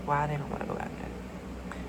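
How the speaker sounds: breathy whisper to herself. Real, not acted. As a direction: whisper it under your breath. Muttering to the stubborn cards.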